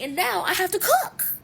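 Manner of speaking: exasperated. Retold or imitated. Late, everyone waiting, exasperated.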